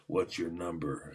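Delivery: lightly voiced older man, low and slow; a hint of a slow, low coach. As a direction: imitate an older man sizing up a player. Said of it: "it was an older man".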